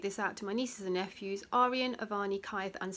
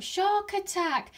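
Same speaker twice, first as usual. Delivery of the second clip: loud, high, drawn-out call; called-out alarm; 11 semitones higher, 7 dB louder, slower than usual. A narrator's reading. Trickster fish calling out to Clam, loud alarm. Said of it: "called out".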